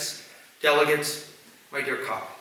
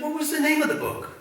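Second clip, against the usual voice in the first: much higher, sing-song praise like a preschool teacher. Retold or imitated. Teacher praising a little boy, high and sing-song. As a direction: pitch it way up to delight the child. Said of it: "She said".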